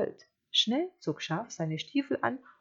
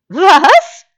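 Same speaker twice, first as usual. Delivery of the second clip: high, very loud sheep yelp; startled sheep; 12 semitones higher, 23 dB louder than usual. Acted. Sheep not understanding the wolf, startled bleat.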